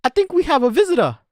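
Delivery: put-on boy voice pitched high and loud, first line slowed; Luke voice. Boy assistant boasting to the Professor, high and eager.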